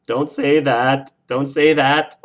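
loud, drawn-out plea; wailed objection to bad news; dismayed protest. Real, not acted. Protests a viewer's grim prediction.

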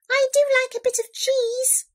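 high, polite mouse voice; little mouse. A narrator's reading. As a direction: squeak a hopeful, dainty request. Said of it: "said the Mouse".

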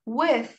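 With said with the tongue between the teeth, a lisped th; lisped th demo. Shown as a demonstration. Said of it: "T diyeceğiz aslında ama o peltek çıkacak".

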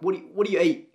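ordinary delivery

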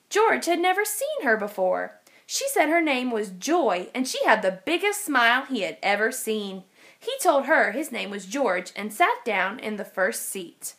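usual speaking voice

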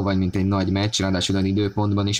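ordinary talk, nothing marked

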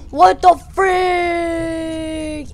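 high, very drawn-out last word; stretched-out hammed-up cry; mock shocked yell. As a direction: play a flung figure wailing in disbelief. Android flung into space.

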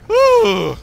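high breathy woo of relief; relieved woo. Real, not acted. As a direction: let out a high shaky woo. After a chase.

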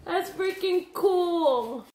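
slow, choked-up and teary; tearful. Real, not acted. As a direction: hold back the tears. Moved to tears.

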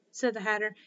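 ordinary delivery

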